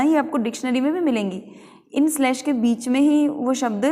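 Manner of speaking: ordinary delivery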